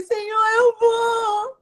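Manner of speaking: high, drawn-out mock-tearful plea; imitating tearful surrender. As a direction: stretch it out high, as if giving in through tears. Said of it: "Aí eu comecei a chorar".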